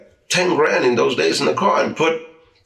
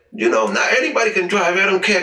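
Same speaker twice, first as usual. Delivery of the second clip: higher, gloating mock of a winner; cocky gloating; 8 semitones higher than usual. Retelling the winner gloating after the race, cocky and higher. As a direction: strut through it like he just won big. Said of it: "the guy gets all cocky".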